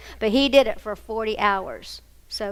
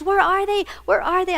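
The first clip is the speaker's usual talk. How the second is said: frantic mother; 8 semitones higher than usual. Retold or imitated. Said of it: "She goes".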